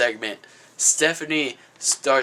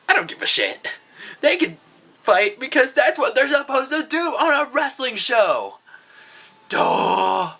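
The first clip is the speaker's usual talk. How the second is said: dismissive Roman; 9 semitones higher than usual. Retold or imitated. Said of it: "And Roman Reigns is like".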